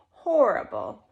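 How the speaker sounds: ordinary delivery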